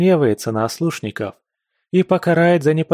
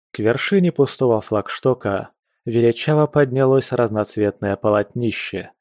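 ordinary delivery, nothing marked

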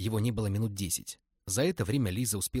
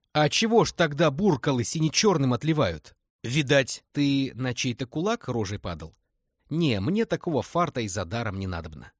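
mocking; 5 semitones higher, 6 dB louder than usual.

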